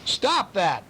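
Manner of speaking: high, sharp command; bellowed. A narrator's reading. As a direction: bark it to stop him. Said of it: "bellowed Missus Little".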